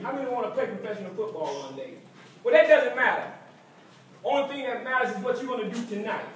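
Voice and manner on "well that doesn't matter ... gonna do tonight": loud, rising fired-up team speech; halftime pep speech. Acted. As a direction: pump the players up before the game.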